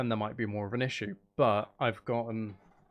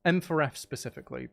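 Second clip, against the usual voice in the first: ordinary delivery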